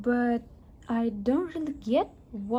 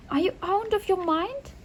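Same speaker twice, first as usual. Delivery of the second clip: higher, indignant disbelief; mother aghast that her son was brought along; shocked scolding; 7 semitones higher than usual. Acted.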